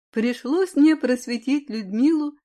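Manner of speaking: ordinary delivery, nothing marked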